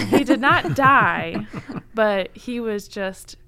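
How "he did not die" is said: laughing it out, slow and loud. Real, not acted. Podcast host laughing to cohosts about pirate's fate. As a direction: laughs through the words as the twist lands. Laughing while telling the story.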